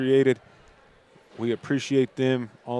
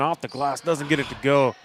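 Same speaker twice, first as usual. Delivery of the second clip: excited basketball call, building louder; live play-by-play; 3 semitones higher, 4 dB louder than usual. Real, not acted. Announcer praising a defensive press as it works, rising excited play-by-play.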